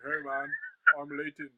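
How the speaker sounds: a light imitation of Leighton's accent; imitating Leighton's accent. Guest mimics Leighton introducing himself, lightly put-on accent. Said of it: "the coolest accent I've ever heard".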